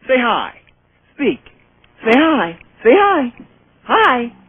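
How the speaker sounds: high pet talk. Real, not acted. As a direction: coax the dog in a high voice. Coaxing dog.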